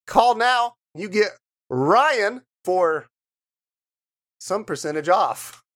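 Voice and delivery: high, eager call now pitch; home shopping host. Pretend. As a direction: push the deal hard. Mock infomercial pitch.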